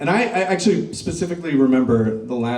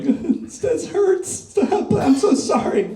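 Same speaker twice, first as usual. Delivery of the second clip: much higher, hammed-up mock sobbing; fake crying; much slower than usual. Thirteen-year-old faking tears for his spanking mother, hammed-up crying. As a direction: overplay the hurt so mom feels she won. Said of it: "I'm just gonna start fake crying".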